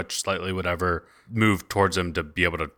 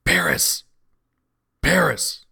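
stage whisper; 3 dB louder than usual. Acted. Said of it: "[name]'s gonna whisper shout".

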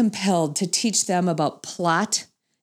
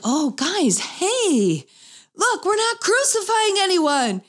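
calling out; 11 semitones higher, slower than usual. Retold or imitated. Mom calling out to kids.

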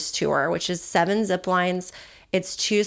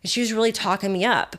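ordinary delivery

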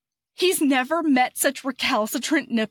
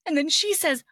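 ordinary talk, nothing marked

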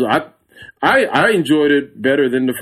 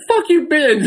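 laughing mock outburst. Answering his wife while laughing high. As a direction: blurt it through helpless laughter. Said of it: "And I was like".

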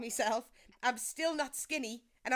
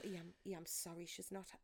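hushed parental apology; 4 semitones lower, 12 dB quieter than usual. Retold or imitated. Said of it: "they used to actually tell people".

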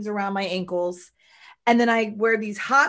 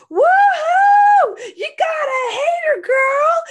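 very high, loud celebratory holler; whooping girlfriend cheer; 11 dB louder, much slower than usual. Retold or imitated. Imitating a girlfriend's video cheering on her hater, whooping. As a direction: whoop it up, stretching the woohoos. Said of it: "She's like".

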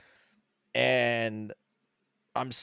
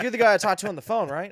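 higher, louder and fast with recognition; eager clerk; 7 semitones higher, 5 dB louder, faster than usual. Retold or imitated. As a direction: suddenly place the customer, eagerly. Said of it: "And he's like".

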